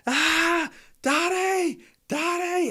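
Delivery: high-pitched shriek for Daddy, imitating a little girl; screaming child. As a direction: scream for help like a panicked child. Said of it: "I just heard Holly screaming".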